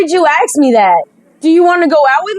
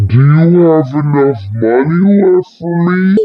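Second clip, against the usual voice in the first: much lower, slow, sly put-on voice; 11 semitones lower than usual. Player voices a greedy reply, low and slow.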